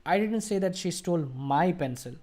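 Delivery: single out "my", keep the rest even; emphatic, slightly held "my"; stress on my. Shown as a demonstration. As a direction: give "my" the weight of the sentence. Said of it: "Let's emphasize my part".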